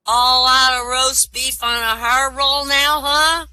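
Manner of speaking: put-on loud, slow, high-pitched drunk. Drunk disappointed by a rose prize, loud slow drunk voice. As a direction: drag it out loudly as a put-on drunk. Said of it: "says the drunk".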